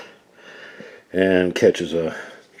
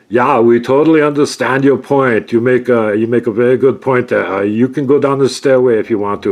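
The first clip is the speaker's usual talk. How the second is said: loud, higher, hasty caving in; terrified guards; 7 semitones higher, 11 dB louder than usual. Acted. Terrified guards give in after the roar, loud and high. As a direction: babble agreement, scared stiff. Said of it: "noticeably shaking with terror".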